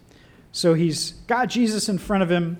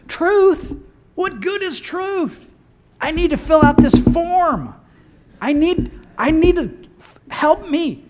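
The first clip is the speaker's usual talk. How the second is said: much higher, strained voice of a fed-up official; exasperated Pilate; 10 semitones higher, 3 dB louder than usual. Acted. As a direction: throw up your hands, pleading for help. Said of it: "he's exasperated, so he says".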